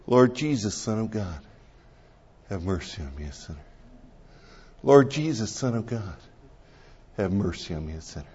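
soft prayer fading toward silence; slow breath prayer. Shown as a demonstration. Pastor showing how he prays himself to sleep, fading murmur. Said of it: "I breathe in, I match my breathing to it".

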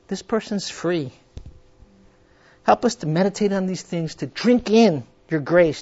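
earnest, measured prayer voice; closing prayer. Acted. Pastor praying to close the study. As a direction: pray sincerely over the group.

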